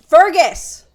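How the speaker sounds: very loud, high shout at the cat; sudden yell. Real, not acted. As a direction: explode into a real yell of the name. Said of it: "I just yelled at you guys".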